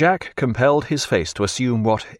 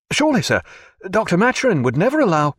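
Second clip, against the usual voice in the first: alarmed protest; 10 semitones higher, faster than usual. Acted. Young officer protests to captain.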